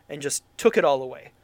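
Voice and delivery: ordinary delivery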